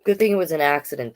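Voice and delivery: ordinary delivery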